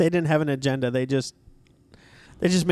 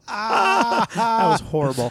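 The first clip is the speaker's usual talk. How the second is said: laughing groan; 6 semitones higher, much slower than usual. Real, not acted. Groaning at a pun.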